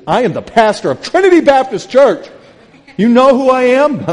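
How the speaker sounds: high, loud pompous bellow; hammed-up outraged big shot; mock self-important shout. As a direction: puff yourself up and thunder it. Mock self-important pastor retort.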